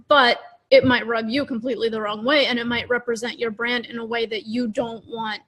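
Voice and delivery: usual speaking voice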